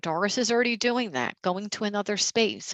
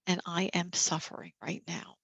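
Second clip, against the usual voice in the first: softer, dropping away gently; softer self-talk; 2 semitones lower, 6 dB quieter than usual. Shown as a demonstration. Caregiver softly admitting suffering to the group, gently quieter. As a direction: let "and I am suffering right now" fall quiet. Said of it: "Just taking a moment and saying".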